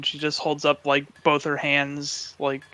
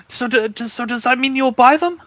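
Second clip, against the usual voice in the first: much higher and louder, stammering with excitement; excited Faye; 9 semitones higher, 7 dB louder than usual. Acted. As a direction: ask breathlessly hopeful. Faye hopes for a sale.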